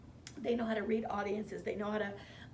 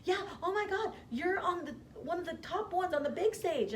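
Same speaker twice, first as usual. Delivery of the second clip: much higher, excited gushing; gushing friends; 9 semitones higher than usual. Retold or imitated. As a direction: squeal it like thrilled friends.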